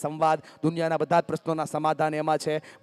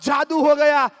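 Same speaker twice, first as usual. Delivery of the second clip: overjoyed, higher and louder, stretched out slow; excited shout; 8 semitones higher, 7 dB louder, slower than usual. Retold or imitated. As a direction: shout the good news like a miracle happened. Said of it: "મને કે". (ખુશીથી બૂમ પાડતી બહેન)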